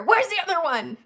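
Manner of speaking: loud, high searching holler; hammed-up retold shout; imitating calling out. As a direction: call across the group excitedly. Imitating him calling out for her.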